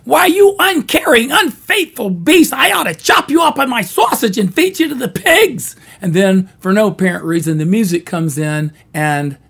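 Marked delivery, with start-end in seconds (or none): 0.0-5.8 high, loud shrieking granny rage; furious Granny. Retold or imitated. Granny rages at her husband, high and loud. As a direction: screech at him like an outraged granny. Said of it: "Granny Loomis responds to him".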